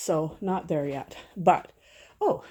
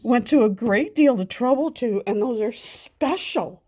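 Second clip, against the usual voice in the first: mom insisting; 6 semitones higher, 5 dB louder than usual. Retold or imitated. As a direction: insist to the kids how special it is. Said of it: "I'm like".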